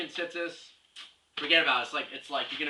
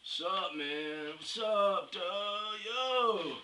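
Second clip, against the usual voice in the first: drawn-out street-slang greeting, pitched up; mock Strama guy; 3 semitones higher, 3 dB quieter, much slower than usual. Strama guy greeting his buddy, put-on slang voice. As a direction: put on a cool dude's "yo" voice. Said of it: "you have the Strama guys".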